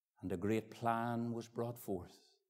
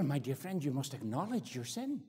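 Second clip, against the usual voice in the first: ordinary talk, nothing marked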